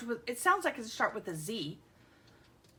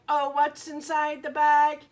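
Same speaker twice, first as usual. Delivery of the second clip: playful; 4 semitones higher, 8 dB louder than usual. Acted. Teasing mystery bag.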